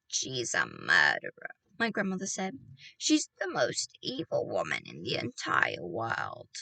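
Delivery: put-on a grave old woman condemning the witch; grandmother's voice. A narrator's reading. Grandmother figure in story.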